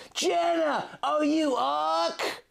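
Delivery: high far voice for Link, calling slowly over wind; Link yelling. A narrator's reading. Link calling to Jenna over the howling wind, high and slow. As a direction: call out to her through a storm. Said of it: "Link yelled over the howling wind".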